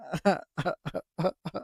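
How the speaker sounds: short breathy laugh; quick amused chuckle. Real, not acted. Laughing at own story.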